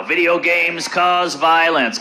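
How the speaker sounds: slow, drawn-out mock claim; mock blaming. Comedian quoting those blaming video games, slowly mocking. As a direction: stretch it out, parroting the accusers. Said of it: "they're blaming the video games now".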